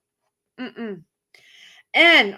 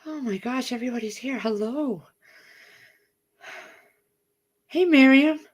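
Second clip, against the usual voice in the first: out of breath. Real, not acted. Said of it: "I'm out of breath".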